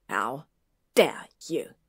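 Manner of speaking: low start, loud hit on "dare"; snarled. A narrator's reading. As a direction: snarl it in outrage. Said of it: "Draco snarled".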